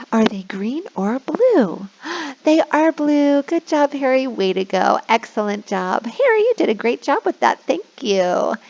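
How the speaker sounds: put-on delight a little kid; kindergarten teacher voice. Shown as a demonstration. Praising child.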